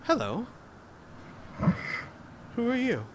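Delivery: high, cheerful greeting; chirpy character voice, lifted high. Acted. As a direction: greet a stranger brightly, pitched well up. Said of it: "quite cheerfully".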